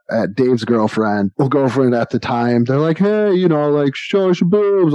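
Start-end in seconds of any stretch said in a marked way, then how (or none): 3.0-4.9 mock drunk heckling. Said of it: "They yell at my".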